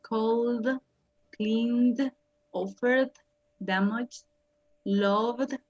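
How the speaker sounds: "Cold, cleaned, offered, damaged, loved" each ending in a voiced D, held slightly. Shown as a demonstration. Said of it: "estos terminan como una D".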